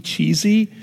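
ordinary delivery

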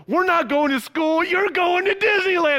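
high, loud excited parent; mock-gleeful parent's shout; surprise announcement. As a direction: hype it up like a big reveal. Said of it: "parents surprising their kids with a trip".

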